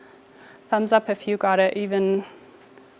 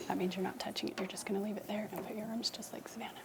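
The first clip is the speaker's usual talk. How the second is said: whisper; 18 dB quieter, much faster than usual. Real, not acted. Teacher quietly directing seated kids.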